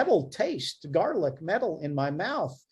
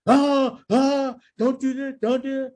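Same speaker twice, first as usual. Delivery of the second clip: much higher, louder mock screaming; mean doctor yelling at a technologist, hammed-up and loud; 10 semitones higher, 5 dB louder than usual. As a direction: imitate a bully doctor snapping at a tech. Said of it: "The doctor yells and screams".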